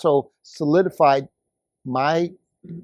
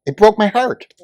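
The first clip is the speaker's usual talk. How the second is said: emotional; 6 semitones higher, 3 dB louder than usual. Real, not acted. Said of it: "You're damn right I was emotional".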